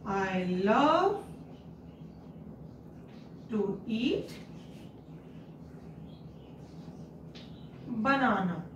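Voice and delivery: phrase by phrase with long pauses; slow dictation. Shown as a demonstration. Teacher dictating to pupils in slow chunks. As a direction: dictate slowly so children can copy. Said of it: "So you can write".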